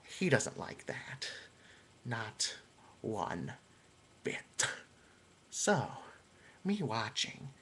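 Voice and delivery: low, soft, slow menacing storyteller; hushed villain confession, fading to a whisper; Joker voice. Acted. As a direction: creep the tale out quietly. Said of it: "this last one I'm going to do".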